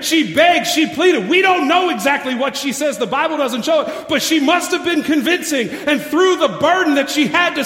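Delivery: loud, high, driving sermon climax; preaching at full force. Acted. Pastor urging the church to save their families, loud preaching. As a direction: preach it hard, pleading with the church.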